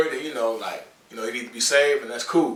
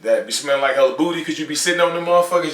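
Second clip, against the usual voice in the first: ordinary talk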